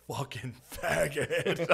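slow, high, sneering hater voice; mocking a hater. Mocking the online haters, slow high sneer. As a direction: drag it out like a mocked troll.

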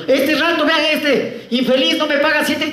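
high-pitched mock tirade; hammed-up scolding, pitched high; ranting landlady. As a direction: rant so all the tenants hear. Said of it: "Baja el volumen".

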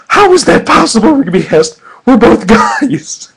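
high, loud disbelieving squeal; can't believe it at all; incredulous. A narrator's reading. Rigby squeals in disbelief at his future kids.

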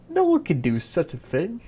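put-on despairing girl.

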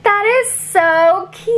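high gushing reaction, toned down from a squeal; redone excited reaction. Shown as a demonstration. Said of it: "let's just redo that".

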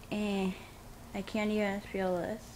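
lip pulled down by finger; talking with numb lip; finger pressing on lip. Real, not acted. Seen 0: finger pressed on lower lip during words, numb.

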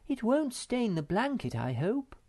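high-pitched worried question, dropping at the end; anxious. A narrator's reading. Moomin Mama frets about her blanket, anxious. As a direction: ask it high, a touch anxious. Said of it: "asked Moomin Mama anxiously".

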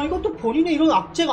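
usual speaking voice

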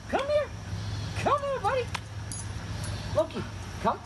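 very high squeaky dog voice; sing-song high call to a puppy; squeaky praising a dog. Real, not acted. Trainer coaxing dog to come.

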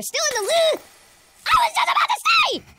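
high, loud yelling; startled shout. Real, not acted. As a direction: shout in fright. Scare right after a remark.

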